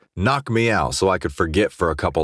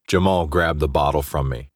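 ordinary delivery

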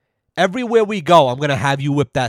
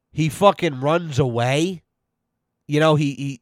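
normal talking voice

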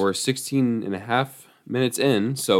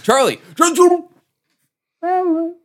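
high, loud mock cry for help; mock panicked shout; 6 dB louder, much slower than usual. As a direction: yell as if being dragged away. Pretending to be kidnapped.

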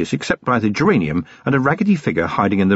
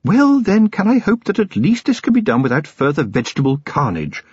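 high-pitched stern lady of the house; stern, upper-class reproof; 8 semitones higher than usual. A narrator's reading. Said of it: "Sybil sighed and said sternly".

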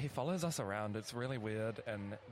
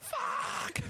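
shrieked curse, much higher; 4 dB louder than usual. Retold or imitated. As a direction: squeal the curse up high. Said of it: "you're like".

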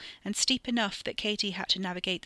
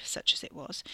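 usual speaking voice

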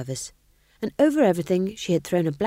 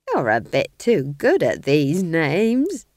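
put-on slow, louder sharp old witch; sharply; 5 dB louder, slower than usual. A narrator's reading. Nanny Og scolding the king, slow and sharp. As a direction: cut in with suspicion. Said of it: "said Nanny sharply".

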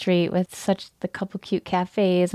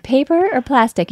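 cashier voice; 7 semitones higher, 4 dB louder than usual. Retold or imitated. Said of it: "she's like".

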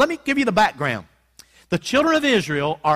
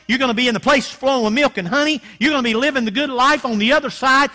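rising, louder sermon voice; preaching at full force; 3 semitones higher, 4 dB louder than usual. Acted. Preacher voicing God's promise to wandering Israel, fervent. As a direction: preach it with growing fire.

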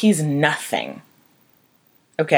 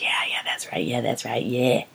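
soft hissing whisper in the ear; whispering grief; 5 semitones lower, 8 dB quieter than usual. Pretend. Grief whispering to the grieving widow, unvoiced whisper. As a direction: breathe it like a voice on your shoulder. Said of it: "whispering in my ear".

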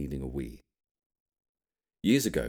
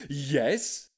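eager yes; 10 semitones higher than usual. A narrator's reading. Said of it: "he said".

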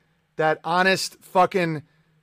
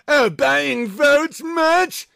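much higher, very slow sneer; smug mock voice; 8 semitones higher, much slower than usual. Streamer mocking critics of debt forgiveness. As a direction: drag it out like a smug know-it-all.

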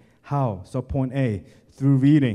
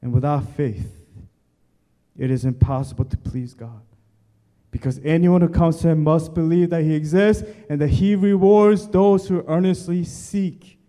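forceful preaching; 5 semitones higher, 9 dB louder than usual. Acted. Preacher pleading at full force.

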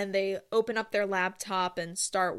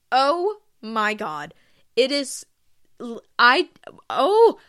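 excited; 3 semitones higher, 8 dB louder, slower than usual. Real, not acted. Gushing about Halloween.

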